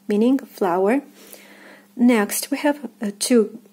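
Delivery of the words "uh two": ordinary talk, nothing marked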